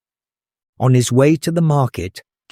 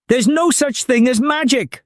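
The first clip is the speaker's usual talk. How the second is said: much higher, furious mother's outburst; angry cry; 12 semitones higher than usual. Acted. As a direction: cry out in rage. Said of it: "she cried".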